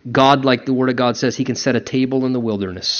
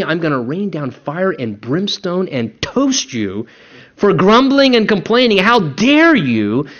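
angry God; 4 semitones higher than usual. Pretend. Preacher imagines God punishing complainers, mock fury. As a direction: build into a wrathful outburst, drag out the last words. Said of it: "I would want to read there".

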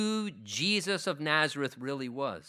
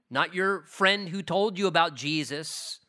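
ordinary talk, nothing marked

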